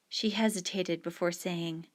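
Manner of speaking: ordinary delivery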